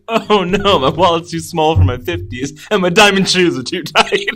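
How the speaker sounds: hammed-up Friends character voice; mock Chandler whine. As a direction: whine like a spoiled rich guy. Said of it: "Chandler is like".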